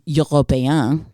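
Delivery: slow, exaggerated European accent on one word; hammed-up European accent; mock European accent. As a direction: say it in a badly faked European accent. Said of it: "He sounds European".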